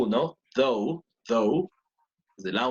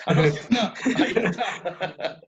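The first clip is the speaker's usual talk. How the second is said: laughing; 3 semitones higher than usual. Real, not acted. Finds the expected Nokia photo.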